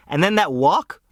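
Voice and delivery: usual speaking voice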